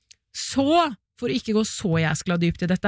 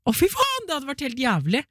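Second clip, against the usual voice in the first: horrified yelp; 7 semitones higher than usual. Real, not acted. Horrified reaction.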